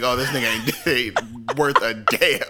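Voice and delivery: drawn-out verdict through laughter; slow it down, cracking up mid-quote; laughing mock disgust. Joking to co-host as whoever finds the body, laughing mock disgust.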